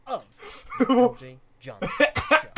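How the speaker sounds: loud, high, laughing host intro; big hammy show opener. Acted.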